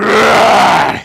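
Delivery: lauter, hoher Kampfschrei; brüll wie ein Orc; orc roar. Acted. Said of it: "he's just like".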